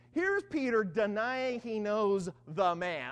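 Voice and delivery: ordinary talk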